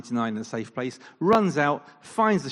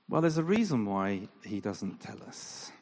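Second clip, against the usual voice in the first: normal talking voice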